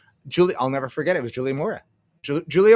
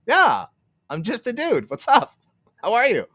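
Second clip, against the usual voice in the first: higher-pitched, chummy imitation; friendly casual reply; 7 semitones higher than usual. Admired streamer answering a fan's email, friendly and raised. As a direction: play the easygoing dude saying hi, pitched up.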